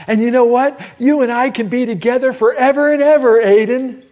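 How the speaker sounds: high, loud, excited reassurance; cheering up a child. Retold or imitated. Grandpa cheering up his grandson, excited and high-pitched. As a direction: lift the little one's spirits brightly.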